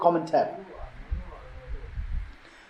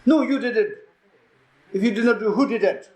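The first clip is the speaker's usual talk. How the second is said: heated, higher-pitched mock accusation; accusing; 5 semitones higher than usual. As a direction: throw the blame back, acting out a quarrel. Acted quarrel between plumber and retreatant.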